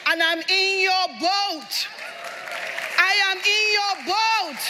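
high, insistent preacher's refrain; full-force preaching. Real, not acted. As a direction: hammer each phrase home to the congregation.

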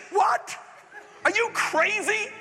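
incredulous shout. Retold or imitated. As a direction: shout it like they think God's lost it. Preacher acting out refusals.

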